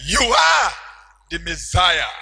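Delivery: loud exclaimed confession; Peter's exclamation. Retold or imitated. Peter declaring to Jesus, shouted. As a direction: cry it out as a revelation. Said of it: "When he said".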